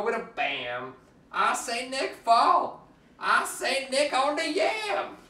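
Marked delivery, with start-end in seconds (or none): none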